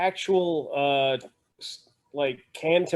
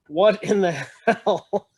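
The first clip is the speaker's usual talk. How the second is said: laughing through the words, higher and slow; laughing disbelief; 5 semitones higher, 3 dB louder, slower than usual. Real, not acted. Showing friends a silly old cookbook, laughing disbelief. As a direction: marvel at the absurd book while laughing.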